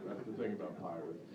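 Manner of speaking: usual speaking voice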